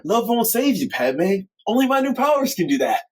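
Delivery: lightly hammed cartoony villain; cartoony Anakin. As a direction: play it like a cartoon bad guy. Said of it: "He said it all cartoony like that".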